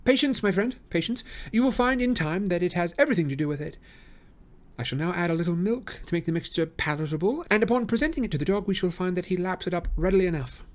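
high, soothing but assured detective; calm the sceptic with certainty; Holmes, patient. A narrator's reading. Holmes to Lestrade, high and patiently assured.

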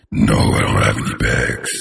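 very low, slow deep voice; deep creepy voice. Shown as a demonstration. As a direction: drop into a deep, menacing rumble. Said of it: "I'm gonna try it at".

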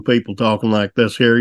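imitated Missouri twang; Joplin twang. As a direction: put on a Joplin country twang. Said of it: "with the twang".

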